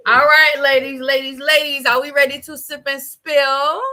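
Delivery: loud host call to the ladies; rally the audience like a host; show host announcement. Acted. Host opens the segment.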